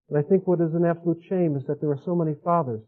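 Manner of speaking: ordinary talk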